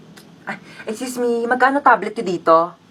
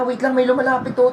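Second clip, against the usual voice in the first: normal talking voice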